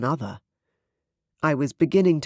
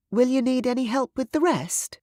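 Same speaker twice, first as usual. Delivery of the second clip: high-pitched helpful servant; servant Isla's voice; 10 semitones higher than usual. A narrator's reading. Servant Isla offering her royal guest help, high-voiced. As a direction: lift the voice high for the servant's question.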